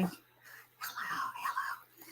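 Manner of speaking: breathy whispered hello. Acted. Greeting viewers, then suddenly whispering. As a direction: drop to a playful whisper.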